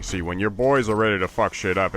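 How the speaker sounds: ordinary talk, nothing marked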